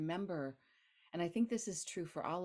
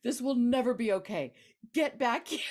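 louder, higher mock telling-off, laughing through it; scolding friend; 7 semitones higher, 10 dB louder than usual. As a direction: boss her back into her body. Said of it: "my friend Moira even said".

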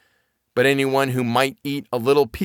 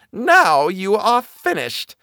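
high, slow sneering witch; wicked witch; 9 semitones higher, 5 dB louder, slower than usual. Acted. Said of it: "said the wicked woman".

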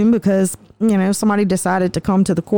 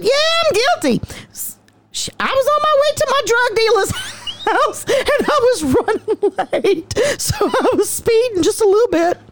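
laughing through a quote; 12 semitones higher than usual. Retold or imitated. Laughing while voicing the defendant.

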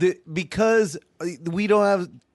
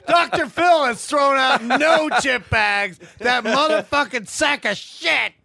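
shouting guru; 8 semitones higher than usual. Acted. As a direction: brag to the crowd.

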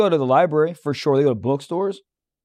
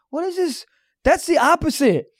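much higher, disbelieving mock outrage; incredulous; 9 semitones higher than usual. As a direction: act out baffled disbelief at bad karma. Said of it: "I was like".

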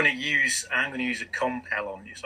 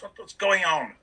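angry sheriff; 8 semitones higher than usual. Acted. Sheriff questions the guards.

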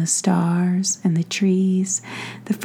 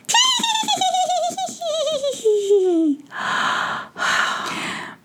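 high pixie cackle. Acted. Pixies laughing.

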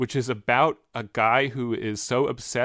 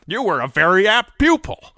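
Jimmy Stewart impression; 6 semitones higher, 8 dB louder than usual. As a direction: give it your Jimmy Stewart. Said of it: "Let's just do it a couple times".

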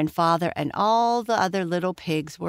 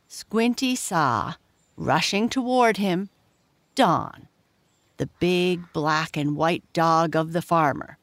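usual speaking voice

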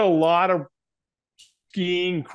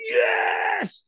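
very high, long-held yell, volume reined in; retold full-lung scream. Firewalker finally going full out for trainer, mock scream. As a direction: imitate screaming yes with arms thrown up. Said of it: "I scream at the top of my lungs".

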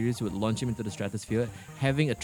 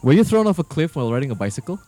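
louder, half-laughing jab; loud teasing; 9 dB louder than usual. Real, not acted. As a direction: tease him loudly with a grin. Teasing comeback question.

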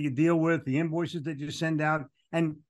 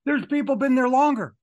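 eager emphatic outburst; excited; 9 semitones higher, 7 dB louder than usual. Real, not acted. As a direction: voice jumps high and loud with amazement. Stressing amazement to listener.

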